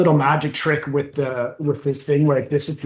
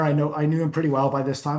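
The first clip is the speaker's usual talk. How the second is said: ordinary talk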